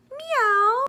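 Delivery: high, loud rising meow; very high playful meow; cat meow. Shown as a demonstration. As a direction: mew like a kitty, brightly. Cat sound saying bye.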